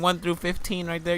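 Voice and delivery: usual speaking voice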